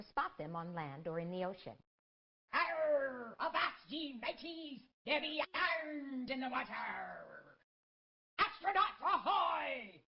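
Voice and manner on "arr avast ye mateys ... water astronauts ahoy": pirate voice, high and loud; old sailor. Acted. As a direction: bellow like an old sea dog. Pirate calls on seeing orange.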